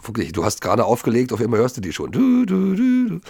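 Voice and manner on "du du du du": sung higher du du like a siren; siren imitation. Describing how fast the fire brigade arrived, imitating the siren. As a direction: mimic the fire brigade siren, pitched high.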